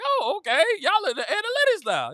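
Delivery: high sarcastic okay; mock okay. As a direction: play along sarcastically. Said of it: "I'm like".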